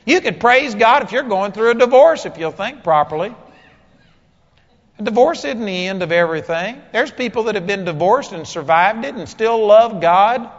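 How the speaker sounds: loud, high-pitched sermon delivery; forceful preaching. Real, not acted. Preacher tells congregation marriage is only temporary, forceful preaching. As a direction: preach it hard, driving each point home.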